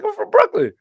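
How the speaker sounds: very high-pitched mock insistence; imitated insistent kid. Quoting the kid repeating his hometown, high insistent imitation. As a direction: squeak it out as the kid's claim.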